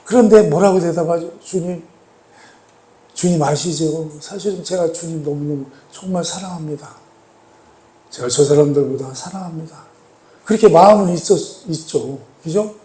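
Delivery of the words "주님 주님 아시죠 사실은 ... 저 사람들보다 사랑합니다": soft, earnest reply; humbled pleading. Acted. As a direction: answer quietly, like a humbled disciple. Voicing Peter's humbled answer to Jesus. (상한 마음으로 나직이 고백하듯)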